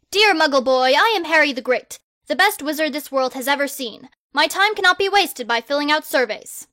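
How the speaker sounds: loud, grand, self-important; pompous boy wizard. Acted. Harry the Great loftily turning down a survey, loud and pompous. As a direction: proclaim yourself like a hero.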